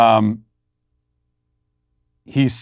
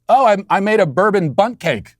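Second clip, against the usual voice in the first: higher, breezy mock-innocent wife; 8 semitones higher than usual. Husband imitating wife confessing the empty bottle, higher mock-innocent voice. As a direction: play her as cheerfully oblivious, a touch hammed.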